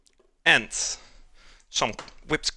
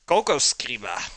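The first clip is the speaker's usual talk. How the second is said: "Coco Scriba" with a light mock German sound; a hint of German on "Coco Scriba". Shown as a demonstration. Streamer quoting a fake German word for pen, light. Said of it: "the, uh, German word for pen".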